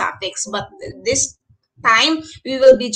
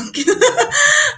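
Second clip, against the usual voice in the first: a sudden high laugh breaking out; high burst of laughter; 3 dB louder than usual. Real, not acted. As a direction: laugh out loud at the student's joke. Teacher laughs again.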